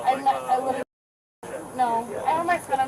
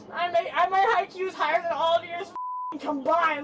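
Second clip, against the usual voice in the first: ordinary talk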